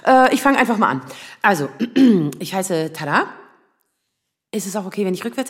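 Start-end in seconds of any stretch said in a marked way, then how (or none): 1.7-2.3 quick nervous throat clear; nervous throat clearing before speaking. Acted. Nervous start of timed story.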